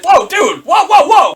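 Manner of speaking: high, loud protest; hammed-up startled outburst; mock alarmed shout. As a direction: yell to rein someone in. His alarmed reaction to Anakin.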